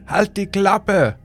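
slow, high furious yell from across the street; shout. A narrator's reading. Neighbour yelling at the sobbing man to shut up, furious shout. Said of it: "schrie ein Mann".

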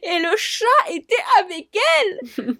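high, hammed-up alarm at fake family news; pretending to take an emergency call to escape a date; mock shocked phone call. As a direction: play up the fake panic as if hearing bad news. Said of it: "j'enverrai des messages de S O S pour qu'on m'appelle et que je dise".

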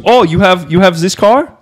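put-on Russian accent, questioning. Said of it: "very strong Russian accent".

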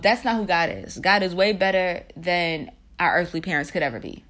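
normal talking voice

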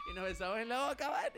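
the big reveal of the kiss; excited. Real, not acted. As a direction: blurt it out, thrilled. Climax of kiss story.

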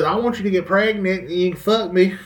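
a hint of a woman asking; quoting a woman. Mocking a woman's offer to the audience, lightly voiced. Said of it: "a woman says".

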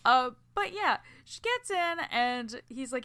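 ordinary talk

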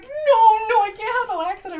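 hammed-up frantic protest; panicked refusal. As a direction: shriek the no, no.

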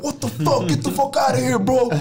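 high, loud imitated yell at a kid; mock bouncer yell. A bouncer yells at the kid who bumped him, loud mock shout. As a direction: bark it like an angry bouncer. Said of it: "Alkaa vaan huutaa".

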